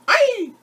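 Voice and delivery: high, loud shriek; el mono gritando agudo; monkey scream. A narrator's reading. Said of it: "El mono gritó".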